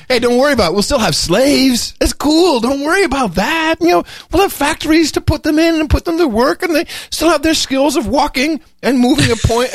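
high mock-reassuring voice; mocking Buffett. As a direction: play a smug tycoon, falsely soothing.

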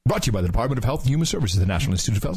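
rapid-fire ad tag; rushed announcer small print; fast voice. Acted. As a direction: race through the sponsor disclaimer. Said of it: "you got the fast voice down".

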